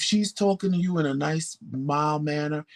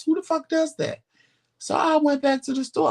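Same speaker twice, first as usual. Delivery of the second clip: outraged; 12 semitones higher, 3 dB louder than usual. Real, not acted. Outraged retelling store dispute.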